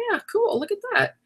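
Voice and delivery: a quiet, high little gasp of wonder; pleasant surprise. Retold or imitated. Coming home to find her backgrounds changed, pleasantly surprised. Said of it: "I was like".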